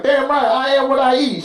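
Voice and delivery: high, proud mock defiance; defiant comeback. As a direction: throw it back loudly, pitch way up.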